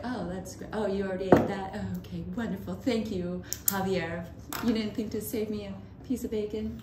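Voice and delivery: fast mock-cheerful sarcasm; mock-cheerful sarcasm at the busser; sarcastic. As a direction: fake delight, dripping irony.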